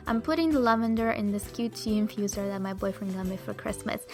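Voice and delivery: usual speaking voice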